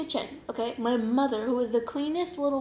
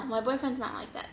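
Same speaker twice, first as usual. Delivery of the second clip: usual speaking voice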